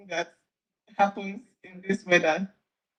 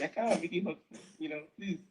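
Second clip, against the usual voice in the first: ordinary talk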